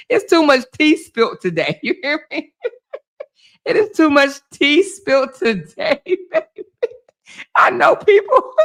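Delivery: laughing gossip. Real, not acted. Gossip reveal cracks her up.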